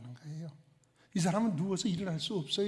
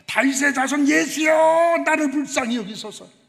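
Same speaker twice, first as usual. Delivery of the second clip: high, loud, slow cry for mercy; pleading yell to a passing crowd; desperate shout; 7 semitones higher, 11 dB louder than usual. Acted. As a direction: call out with all you have, like a blind beggar. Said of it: "그가 소리를 질렀습니다".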